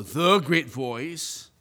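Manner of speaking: THE stretched out slowly before great voice; drawn-out, emphatic THE; stress on THE. Shown as a demonstration. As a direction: inflate THE into a grand title. Mock grand title, contrasted.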